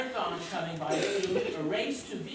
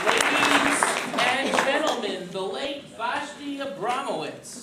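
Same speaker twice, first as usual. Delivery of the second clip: big announcer call, higher and louder; stage announcer; 6 semitones higher, 7 dB louder than usual. Acted. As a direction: announce the act to the crowd.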